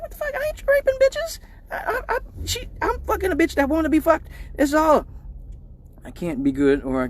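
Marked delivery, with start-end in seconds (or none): 0.0-5.1 panicked protest. Retold or imitated. Panicked reply to cop question.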